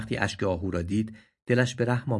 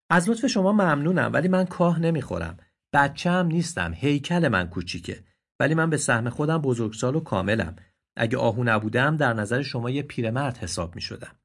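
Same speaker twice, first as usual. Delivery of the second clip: higher, polite but firm; proud young deer; 4 semitones higher, 3 dB louder than usual. Acted. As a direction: stand on dignity, politely. (آهوی مغرور و مؤدب)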